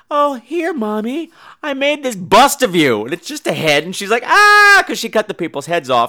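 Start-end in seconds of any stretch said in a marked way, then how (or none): 0.1-3.0 high-pitched, sugary daughter; daughter's fake-nice presenting. Daughter gives Mommy a dubious gift, high and fake-nice. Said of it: "supposedly looking nice, like". 4.3-4.8 high, loud shriek of horror; hammed-up startled scream; mock scream. Mother shown a head by her daughter, mock scream.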